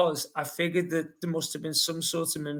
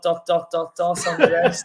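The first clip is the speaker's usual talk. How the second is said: laughing; 4 dB louder than usual. Real, not acted. Joke punchline with laugh.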